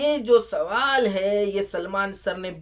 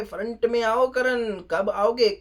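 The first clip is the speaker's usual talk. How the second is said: ordinary talk, nothing marked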